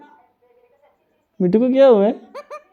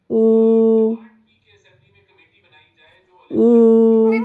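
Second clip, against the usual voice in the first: long, steady high 'ooo' wail; mock crying; 3 semitones higher than usual. Shown as a demonstration. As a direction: imitate crying with one drawn-out hoot. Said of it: "कैसे रोते हैं?". (रोने की नकल, लंबी 'ऊ')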